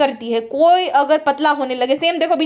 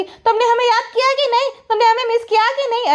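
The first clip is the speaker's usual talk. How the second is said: much higher, coy mock voice; mimicking needy whine; 9 semitones higher than usual. Vlogger imitating the woman asking her aunt if she was missed, higher coy voice. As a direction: ape her fishing for attention. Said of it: "पेटी खाला को बोलती".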